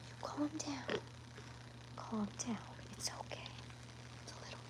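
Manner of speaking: soft hushed whisper to soothe; whispering to settle a restless pig. Real, not acted. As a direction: whisper her down gently. Calming the squirming pig.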